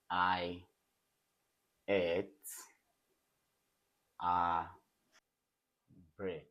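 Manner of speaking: each word set apart by long pauses. Shown as a demonstration. Teacher giving the class an example sentence, word by word. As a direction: dictate it slowly, one word at a time.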